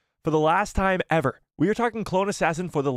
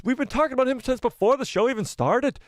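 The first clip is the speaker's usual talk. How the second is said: put-on high-pitched, playful Irish accent; 9 semitones higher than usual. Streamer replaying his slip into an Irish accent. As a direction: repeat it lightly in a raised Irish accent. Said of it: "an Irish accent".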